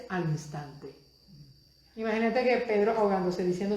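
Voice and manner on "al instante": "al instante" said lower and much softer; "al instante" murmured low and quietly; softly spoken. Shown as a demonstration. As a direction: drop "al instante" almost to an aside. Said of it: "la próxima palabra que tú vas a subrayar es".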